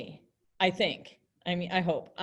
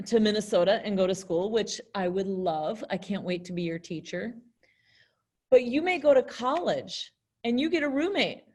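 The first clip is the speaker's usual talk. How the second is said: ordinary talk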